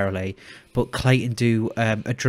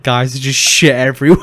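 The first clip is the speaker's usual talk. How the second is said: loud; 2 semitones higher, 10 dB louder than usual. Retold or imitated. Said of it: "he come back and was like".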